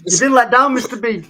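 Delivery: laughing mockery of a friend; laughing tease. Real, not acted. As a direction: rib him through laughter. Teasing co-host while laughing.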